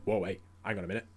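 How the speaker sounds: quick, quiet, timid backpedal; hurried, hushed retreat from a fight; backing off nervously. Retold or imitated. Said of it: "Bulldog just sort of stepped off and just went".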